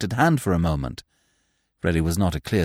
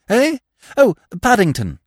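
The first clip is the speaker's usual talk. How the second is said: higher, louder flustered reply; a hurried young man telling the driver where to go, higher and louder; Freddy flustered; 12 semitones higher, 6 dB louder than usual. Acted. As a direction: blurt the destination.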